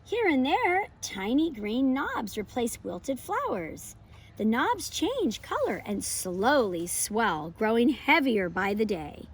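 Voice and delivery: lively, expressive picture-book read-aloud; reading to children. Acted. Teacher reading a pumpkin book to her class, bright and expressive. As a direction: read it like story time for little kids.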